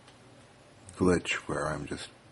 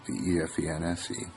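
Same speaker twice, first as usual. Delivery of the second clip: spelling out fast; faster than usual. Shown as a demonstration. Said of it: "spelled out".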